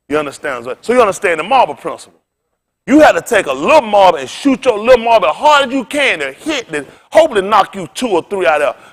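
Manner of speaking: normal talking voice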